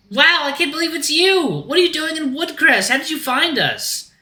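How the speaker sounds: very high, amazed character voice; thrilled Axel voice. Acted. Axel is amazed to meet Russell, high thrilled voice. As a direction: gush in a tiny high voice.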